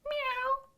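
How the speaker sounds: a kitten-like meow; high meow. Acted. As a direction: mew it like a tiny kitten. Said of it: "I said".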